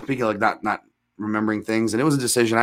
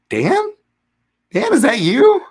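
much higher, bright call across the room; surprised girl calling out; 13 semitones higher, 6 dB louder, slower than usual. Retold or imitated. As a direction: call out in a high girl's voice.

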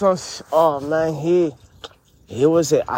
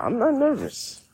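denial jumps up into a much higher voice; high-pitched voice; 9 semitones higher, 4 dB quieter than usual. Real, not acted. Insisting to Annie he isn't nervous, in a high voice. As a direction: say it pitched way higher than your own. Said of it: "talking with a high-pitched voice".

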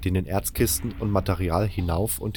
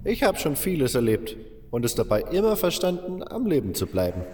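put-on higher old-man voice recalling the past; old miner reminiscing; 6 semitones higher than usual. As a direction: tell it like a veteran who survived it all.

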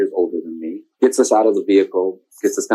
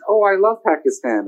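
high mock voice of someone mixing up places; higher, goofy misunderstanding; a high teasing mimic of the mix-up; mock confused listener; 8 semitones higher than usual.

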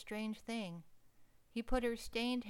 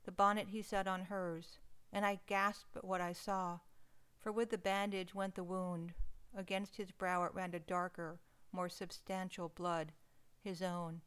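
usual speaking voice

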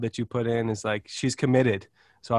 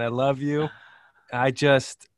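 ordinary talk, nothing marked

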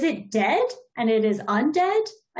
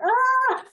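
exasperated cry; 14 semitones higher, 3 dB louder than usual. Retold or imitated. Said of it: "she's like".

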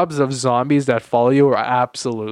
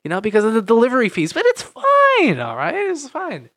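high sing-song careless pitch; mock carefree voice; 10 semitones higher than usual. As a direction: airily brush off the money. Mock bad financial advice.

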